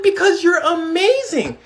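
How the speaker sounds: high, slow gushing praise; amazed praise. Retold or imitated. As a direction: lay the wonder on thick. Praising the kid.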